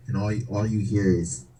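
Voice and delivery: ordinary delivery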